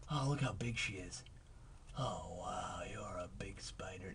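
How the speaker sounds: soft unvoiced whisper. Real, not acted. Whispering to a spider while moving it, hushed. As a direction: hush it so the spider stays calm.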